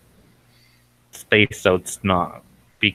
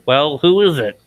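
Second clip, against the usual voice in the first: loud, raised-pitch call to someone at the door; yelled question; 6 semitones higher, 6 dB louder than usual. Acted. Man in the house to the woman at the door, yelling. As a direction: yell it out as an irritated householder. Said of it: "a man yell at her".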